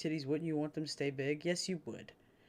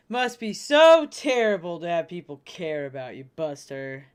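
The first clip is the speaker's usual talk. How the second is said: mock sympathy; 11 dB louder than usual.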